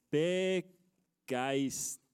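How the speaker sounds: very slow, drawn-out word; syllable by syllable. Real, not acted. Preacher writing up the day's motto, drawn out slowly. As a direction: spell it out in separate, stretched syllables.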